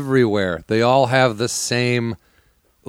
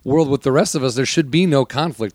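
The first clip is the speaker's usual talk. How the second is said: ordinary talk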